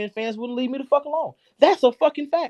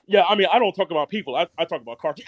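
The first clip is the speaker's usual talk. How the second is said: low, quick mimicry of an opponent; dropped-pitch mocking imitation; mocking excuse-maker; 9 semitones lower, faster than usual. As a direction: parrot the excuse in a low mock voice. Mimics opponent's excuse before retorting.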